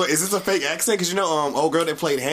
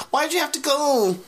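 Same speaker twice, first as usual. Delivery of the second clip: mock plaintive; 8 semitones higher than usual. Mimicking a character's plaintive question.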